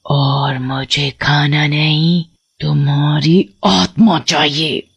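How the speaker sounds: low, slow witch voice, savouring each word; a witch claiming a soul; menacing witch. Acted. Witch telling the boy she wants his soul, low and slow.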